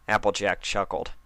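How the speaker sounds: ordinary delivery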